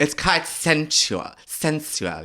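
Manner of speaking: slow, camp posh accent. Shown as a demonstration. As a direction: savour it in exaggerated posh. Still demonstrating the camp posh voice.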